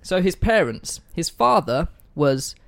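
ordinary delivery

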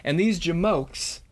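tough-guy mob talk, played for laughs; mobster. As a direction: play a mock gangster laying down turf rules. Acted mobster talk in recap.

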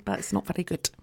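ordinary talk, nothing marked

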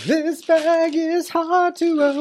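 high, slow mocking echo. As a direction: parrot her words in a silly high voice. Echoes her line in silly voice.

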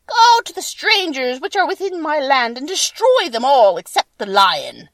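loud, high witch giving orders; wicked witch voice. A narrator's reading. Wicked Witch sending the monkeys to destroy strangers, loud high command.

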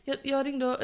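ordinary talk

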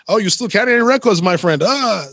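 high, bright mock Spanish accent of a club DJ. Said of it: "so excuse the accent".

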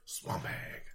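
hushed spooky swamp hag; quiet. Shown as a demonstration. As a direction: say it low and eerie. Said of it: "which sounds cooler".

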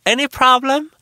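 high, loud, sassy "Any problem?"; squaring up with sass; mock challenge. Challenging the two women, high and loud.

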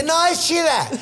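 high cartoon objection; little bread. Bread vouching for the old woman, high cartoon voice. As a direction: insist in a silly high creature voice. Bread reassures the frightened girl.

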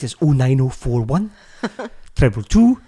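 phone-vote number in put-on Welsh; lightly botched accent gone Welsh; mock Welsh accent. Podcaster parodying a TV phone-vote line, mock Welsh. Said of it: "Oh, we're Welsh again".

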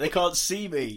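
slow words with tissue up the nostril; toilet paper up nose. Real, not acted. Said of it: "with your toilet paper nose".

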